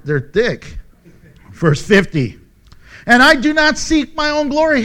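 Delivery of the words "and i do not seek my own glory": high, loud, firm declaration; emphatic scripture reading. Acted. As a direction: declare the verse at raised pitch and volume. Preacher reads verse out forcefully.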